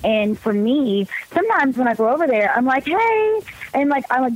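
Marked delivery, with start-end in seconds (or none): 2.8-3.4 outgoing. Retold or imitated. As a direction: call out a big, high "hey". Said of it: "I'm just kinda like".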